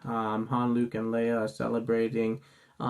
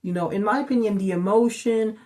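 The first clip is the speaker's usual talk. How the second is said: excited; 9 semitones higher, 5 dB louder than usual. Real, not acted.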